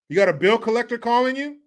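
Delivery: ordinary talk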